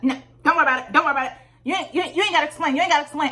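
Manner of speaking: mock his sassy brush-off. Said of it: "real sassy".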